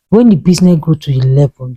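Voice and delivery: usual speaking voice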